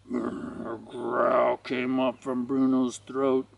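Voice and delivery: low, slow, growl-like telling; ominous narration. A narrator's reading. Narrator as Bruno's growl rises, ominous. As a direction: draw it out low and slow with menace.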